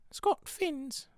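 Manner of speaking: very high put-on squeal; imitated sketch voice. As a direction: pop it out in a high comedy falsetto. Sketch voice returns as inspiration.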